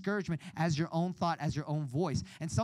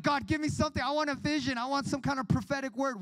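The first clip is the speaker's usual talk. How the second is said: eager plea; 8 semitones higher than usual. Retold or imitated. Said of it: "I was like".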